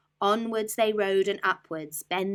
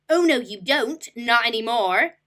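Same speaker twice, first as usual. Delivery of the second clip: loud, higher retort; declared; 6 semitones higher, 10 dB louder than usual. Acted. Rat claiming the ants' leaf, loud declaration. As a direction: snap back and claim it. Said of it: "declared the highway thief".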